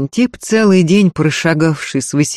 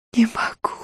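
high, soft, whispery groan; short moan; 6 semitones higher, 7 dB quieter than usual. A narrator's reading. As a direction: moan it briefly, voice nearly gone. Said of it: "коротко простонала та".

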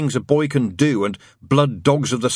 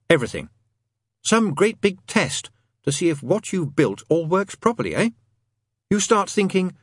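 higher-pitched ranting demon, swooping emphasis; Crowley; 7 semitones higher than usual. Acted. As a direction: keep pressing the argument, question after question.

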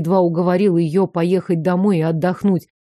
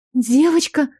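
higher, suspenseful question; breathless hope; 4 semitones higher than usual. A narrator's reading. Mother-to-be asking the doctor, hopeful suspense. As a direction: ask with your heart standing still. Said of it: "с замиранием сердца спросила я".

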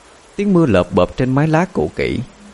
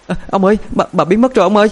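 high, stammering alarmed call; frightened servant's high-pitched cry; panicked cry; 11 semitones higher, faster than usual. A narrator's reading. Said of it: "kêu lên thất thanh".

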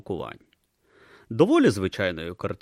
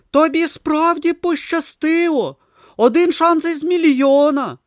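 Brutha marvelling; 9 dB louder than usual. Acted. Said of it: "зронив Брута".

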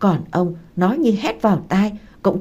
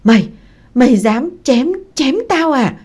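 shocked stammer rising high; halting start, then a high, pained outburst; panicked stammer; 6 semitones higher, 5 dB louder than usual. A narrator's reading. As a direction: stammer in horrified disbelief. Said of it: "hoảng kinh ôm lấy tay lắp bắp".

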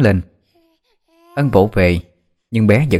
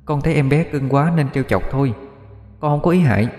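put-on mischievous ghost girl; 6 semitones higher, 3 dB quieter than usual. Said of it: "khuôn mặt lý lắc nghịch ngợm".

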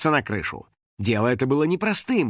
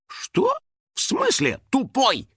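high-pitched offended disbelief; squeaky outrage; indignant; 11 semitones higher, slower than usual. A narrator's reading. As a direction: take slow, stung offence at the insult. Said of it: "возмутился финик".

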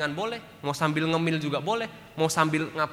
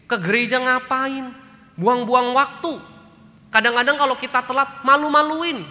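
higher, louder and slow, whining every excuse; drawn-out complaining, loud and high; mock grumbling husband; 10 semitones higher, 10 dB louder than usual. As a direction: ham up a lazy husband who won't go to church. Husband grumbles against church.

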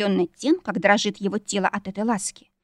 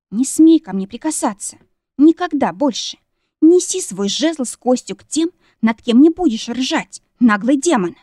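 loud, high indignant tirade; wounded shouting; 4 semitones higher, 6 dB louder than usual. A narrator's reading. Wife raging at mocking husband, loud and high. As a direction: lash out, raised and offended. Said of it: "закричала, уязвлённая до глубины души".

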